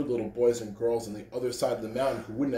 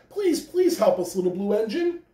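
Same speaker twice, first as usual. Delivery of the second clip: begging; 8 semitones higher than usual. A narrator's reading. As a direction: beg with everything. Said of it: "cried the dolls and the toys".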